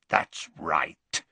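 low werewolf rasp; Greyback rasp. Acted. Greyback to Dumbledore, a smug low rasp. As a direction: rasp it smugly. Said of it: "said Greyback".

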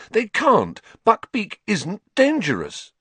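put-on outraged young Harry; upset protest. A narrator's reading. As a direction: protest in disbelief. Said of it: "said Harry".

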